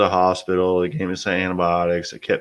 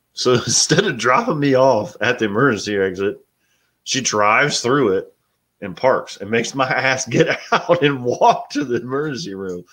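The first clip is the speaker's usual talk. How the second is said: raised, chuckling retelling; laughing while telling; 5 semitones higher than usual. Real, not acted. Husband laughing as he recounts wife driving through the emergency entrance. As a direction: laugh through the story of the drive.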